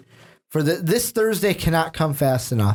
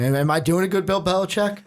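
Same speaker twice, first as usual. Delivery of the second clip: usual speaking voice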